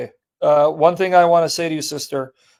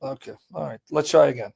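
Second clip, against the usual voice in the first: ordinary delivery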